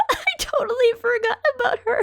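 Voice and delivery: high, rushed words through laughter; laughing admission. Real, not acted. Fan admitting she forgot a character, laughing. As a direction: blurt it out quickly, pitched high with laughter.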